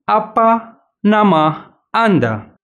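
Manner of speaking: Apa nama Anda said very slowly, word by word; slow teacher demo; slow model phrase. Shown as a demonstration. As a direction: spell it out slowly for learners to repeat. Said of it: "مرة أخرى".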